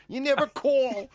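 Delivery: high, nagging mother impression; guilt-trip like a doting mother. Shown as a demonstration.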